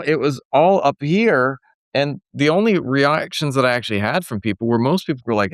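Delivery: ordinary talk, nothing marked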